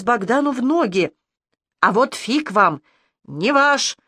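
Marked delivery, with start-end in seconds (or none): none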